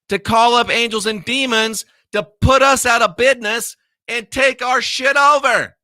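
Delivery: rising into a yell; shouting. Real, not acted. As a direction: build to an angry shout. Escalating rant ending in shout.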